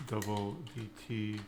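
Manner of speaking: usual speaking voice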